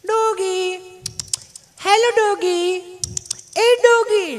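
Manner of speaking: over-sweet Doggy, hello Doggy; mock cooing at a doggy. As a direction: call the dog like a city lady cooing. Visitor calling the dog sweetly.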